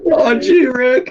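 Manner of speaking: high whiny Morty voice; Morty impression. Player mimicking Morty, high and whiny. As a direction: squeak it like Morty.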